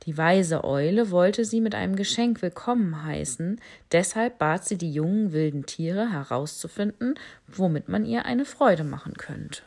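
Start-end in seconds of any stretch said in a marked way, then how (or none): none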